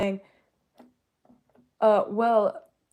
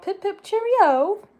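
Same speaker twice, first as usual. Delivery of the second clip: very high, slow, put-on British "Pip, pip, cheerio"; sing-song mock posh British greeting; exaggerated mock British accent; 13 semitones higher, 5 dB louder, much slower than usual. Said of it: "You won't get one of those accents from me".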